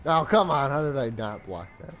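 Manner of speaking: loud, exasperated "oh, come on"; frustrated outburst. Real, not acted. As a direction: yell at the screen in disbelief. Goal conceded in a game.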